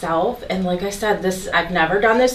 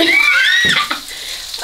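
shrieking laugh; 3 dB louder than usual. Real, not acted. Nervous cooking laugh.

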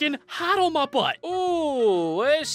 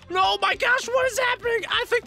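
alarmed; 12 semitones higher than usual. Real, not acted. Elephant attacks him.

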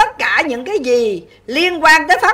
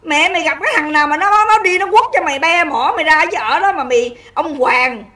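usual speaking voice

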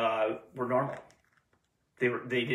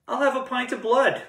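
vampire's order; 12 semitones higher than usual. Retold or imitated. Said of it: "It'd be funnier if I used the vampire accent".

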